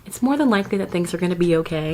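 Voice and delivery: usual speaking voice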